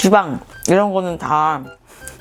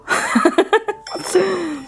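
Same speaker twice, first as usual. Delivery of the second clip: high laugh; 11 semitones higher than usual. Real, not acted. Laughs at own joke.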